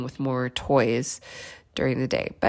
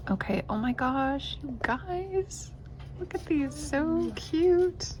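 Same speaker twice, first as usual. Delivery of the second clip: high squealy delight, softer; excited; 9 semitones higher, 4 dB quieter, slower than usual. Real, not acted. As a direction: gush over something cute. Excited at decorations.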